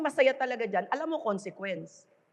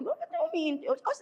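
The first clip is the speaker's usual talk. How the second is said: mock squeal; 10 semitones higher than usual. Mocking a shouting habit.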